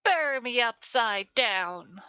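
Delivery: loud, drawn-out psycho yell. Acted. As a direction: holler it high and slow like a game psycho. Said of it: "hearing the psychos yell all kind of random".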